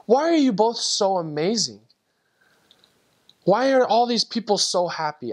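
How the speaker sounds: imitating overwhelmed wonder, high-pitched; tearful wonder. Tearful convert questioning both missionaries, overwhelmed. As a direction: ask in high-pitched, pleading amazement. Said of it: "And he cries even more".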